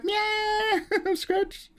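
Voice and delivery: very high, tiny cat cry, breaking into a laugh; a cartoon cat pounces on the villain, high and giggly; squeaky cat voice. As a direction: squeak the attack like a kitten, then crack up. Cat voice attacking.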